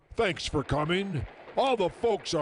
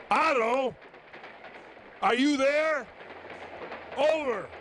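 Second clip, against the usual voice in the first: calling out, pitched up; louder calling; 5 semitones higher than usual. Acted. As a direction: raise the voice to reach him. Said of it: "Otto? said first plainly".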